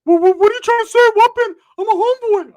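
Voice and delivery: very high, loud indignant squeal; exaggerated high-pitched outburst; Ricky. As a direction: protest in a goofy high cousin voice. Ricky protests on the phone.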